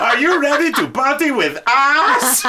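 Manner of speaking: laughing through a loud shout. Retold or imitated. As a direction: crack up mid-line. The line while she points behind.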